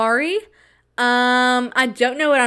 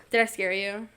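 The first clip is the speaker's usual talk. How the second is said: usual speaking voice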